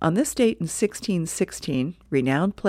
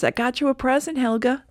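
higher, cheery voice for Hagar; Hagar's line; 8 semitones higher than usual. Acted. Hagar presenting a gift to his wife, lightly voiced and cheery. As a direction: proudly hand her the gift.

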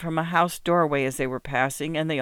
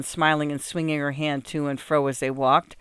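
usual speaking voice

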